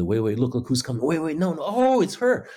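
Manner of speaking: rising, hurried mock commentary climbing to a high cry; excited audience chatter. Older theatregoers talking to each other during the play, excitedly. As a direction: imitate chattering theatregoers, building to an excited discovery. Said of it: "they're talking to each other all the time".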